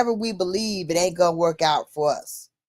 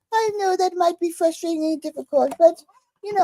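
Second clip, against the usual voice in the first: higher, soothing mock assistant; phone AI voice; 9 semitones higher, faster than usual. The AI sympathizing with her, lightly put-on assistant voice. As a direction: soothe her like the polite AI, pitched up. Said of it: "talking to me in a British voice".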